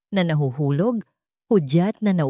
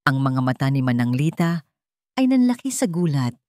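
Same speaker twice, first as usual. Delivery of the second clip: ordinary delivery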